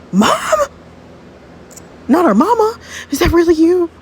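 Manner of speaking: high, loud astonished questions; high-pitched stunned recognition; shocked disbelief. Acted. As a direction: cry out in disbelief at mother. Sees dead mother alive.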